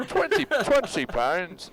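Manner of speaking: drawn-out Scottish-sounding attempt; Irish accent turned Scottish. Reading out a donation, accidentally Scottish accent. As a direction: try Irish and slide into Scottish. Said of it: "Ah, that's Scottish".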